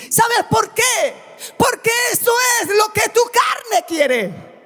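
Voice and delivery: full-force pulpit preaching; fiery preaching. Acted. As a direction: preach it to the whole church.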